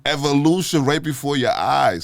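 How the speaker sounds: loud, high, punching evolution; excited. Real, not acted. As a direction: proclaim it like a big reveal. Excited exclamation after joke.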